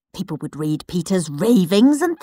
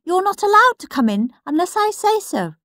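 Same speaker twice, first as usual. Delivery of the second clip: much higher, prim little brother voice; indignant Peter; 11 semitones higher than usual. Acted. Peter ordering Henry out of his bedroom, high and prim. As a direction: shoo him out, high and prissy. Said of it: "said Peter".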